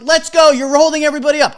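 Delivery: loud, pushy bark; rude version. Pretend. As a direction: snap at them like the jerk he wasn't. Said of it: "I wasn't an asshole".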